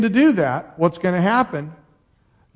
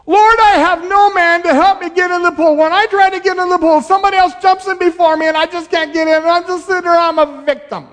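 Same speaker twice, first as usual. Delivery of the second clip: whiny victim voice; 13 semitones higher, 6 dB louder, faster than usual. Acted. Mocking the man's excuses.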